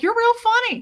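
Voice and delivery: high, loud imitated compliment; excited mimicry. Officer's compliment retold.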